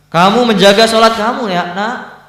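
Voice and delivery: high, loud, urgent question to the son; eager father. Acted. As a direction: call out to the son, bursting to know. Father questions his long-lost son.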